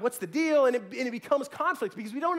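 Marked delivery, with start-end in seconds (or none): 0.0-0.7 insecure outburst. Retold or imitated. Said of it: "I'm like".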